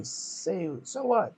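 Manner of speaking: mildly bitter, rising at so what; a scarred teen snapping back; bitter retort. Acted. Scowling teen bitterly answers the Lord Captain, mild bitterness. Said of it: "says bitterly".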